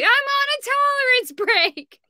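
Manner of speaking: high, loud, drawn-out mock protest; Dan. Cohost imagining Dan's soundboard clip, exaggerated irate mimicry. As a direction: wail his outburst, hammed-up and indignant. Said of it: "It's gonna be Dan the next night".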